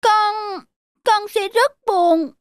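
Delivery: put-on high child voice, slowing and downcast; sad little boy. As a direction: say it like a small boy about to be sad.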